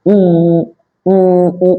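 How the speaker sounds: উ hummed with lips shut, muffled; muffled closed-mouth উ. Shown as a demonstration. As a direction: keep your mouth shut and try উ. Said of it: "মুখটাকে বন্ধ করে দিই".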